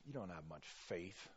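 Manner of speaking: low, soft dismissive reproach; muttered disapproval. Acted. Preacher showing what Jesus is not saying, muttered disapproval. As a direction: mutter it down at them, written off. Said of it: "what I don't hear him saying".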